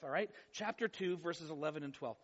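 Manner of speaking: ordinary talk, nothing marked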